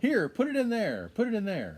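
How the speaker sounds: high, loud mock shouting; angry neighbor. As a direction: order them like an irate neighbor. Acting angry man chasing him.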